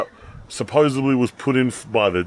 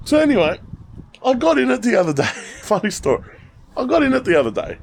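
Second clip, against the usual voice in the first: excited; 10 semitones higher, faster than usual. Real, not acted. Excited start of funny story.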